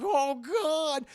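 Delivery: high, loud oh God; frightened gasp. Retold or imitated. Scientists hearing the life sign vanished, frightened. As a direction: gasp it out scared.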